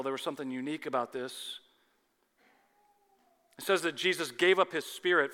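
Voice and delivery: usual speaking voice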